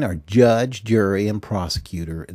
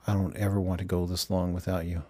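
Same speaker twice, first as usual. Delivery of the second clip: fast, hushed whisper; 6 dB quieter, faster than usual. Acted. Judy whispering longingly to Bob on the phone. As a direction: whisper it close, like a secret meant only for him.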